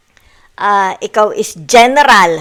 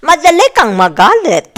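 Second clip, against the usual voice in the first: laughing; 7 semitones higher, 7 dB louder than usual. Real, not acted. Laughing while teasing about temper.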